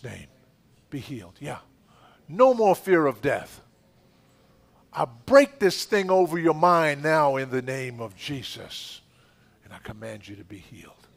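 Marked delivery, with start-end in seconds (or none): 2.3-9.0 loud, high commanding prayer, building; preaching at full force. Real, not acted. Pastor commanding fear to leave a praying woman, loud preaching shout. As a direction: cast out her fear with a booming voice.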